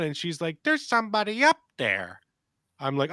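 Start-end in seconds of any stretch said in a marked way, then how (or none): 0.6-2.2 put-on higher small child voice stating it plainly; matter-of-fact toddler voice; imitating little daughter. Father imitating his daughter telling him someone is upstairs, high child voice.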